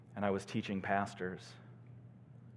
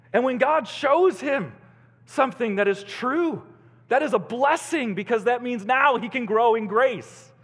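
raised, louder preacher's voice; forceful preaching; 12 semitones higher, 9 dB louder than usual. Real, not acted. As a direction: proclaim it urgently to the congregation.

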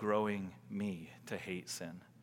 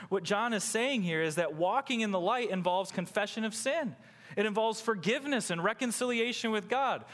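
raised, ringing sermon voice; full-force preaching; 12 semitones higher, 5 dB louder than usual. Real, not acted. Preacher proclaiming forgiveness to the congregation, impassioned preaching. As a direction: proclaim it to the congregation, pitched high.

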